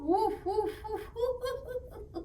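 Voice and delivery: high playful woofs, pretend dog; high puppy woofs. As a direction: yap like a little toy dog. Playing with dog craft buddy.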